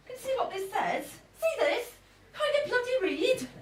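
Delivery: high, sharp scolding of strangers; irate telling-off. Acted. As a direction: tell them off, outraged. Confronting noisy spa guests.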